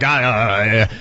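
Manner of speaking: low, noncommittal mumbled hedge, retold; downplaying. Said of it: "I'm, I'm downplaying it".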